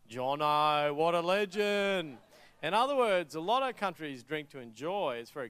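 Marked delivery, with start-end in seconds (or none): 0.1-2.2 loud, drawn-out mock cheer; slow, big, hammed-up Aussie mate cheer. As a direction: hail a drunken mate as a hero. Acted cheer for passed-out mate.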